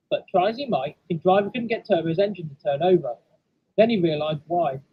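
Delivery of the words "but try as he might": ordinary delivery, nothing marked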